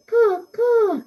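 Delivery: high-pitched imitation of a crow cawing; crow call. Teacher reads a crow poem to pupils, high crow caws. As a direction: caw twice, high above your reading voice.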